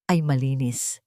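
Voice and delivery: plain reading voice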